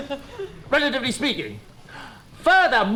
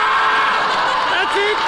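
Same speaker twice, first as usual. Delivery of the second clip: queen ant death cry; 7 semitones higher, 4 dB louder than usual. Pretend. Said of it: "queen ant ... goes".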